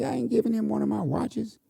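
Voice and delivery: put-on high outraged kid voice; indignant refusal. Boy refusing his mother, high and indignant. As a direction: protest like a kid who won't share. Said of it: "I was pissed off".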